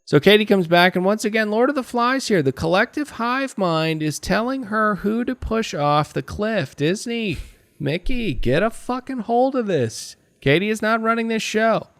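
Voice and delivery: worked-up high-pitched tirade; exasperated rant. Real, not acted. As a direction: pitch shot way up, incredulous. Mock outrage rant at the show.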